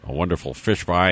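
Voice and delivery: normal talking voice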